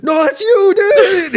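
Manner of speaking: very high, loud mock shout; retold starstruck yell, breaking into laughter; popping off. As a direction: imitate a fan freaking out in recognition. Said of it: "he's, like, popping off".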